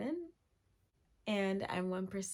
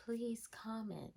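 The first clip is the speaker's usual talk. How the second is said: slow, softer whispered plea; pleading whisper; 5 dB quieter than usual. Acted. Begging her viewers to comment, in a slow pleading whisper. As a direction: whisper it like a desperate little beg.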